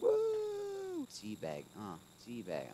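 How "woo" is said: excited whoop. Real, not acted.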